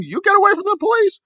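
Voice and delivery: high, hammed-up menace. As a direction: parody his threat in a high voice. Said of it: "threatened D O A with physical violence".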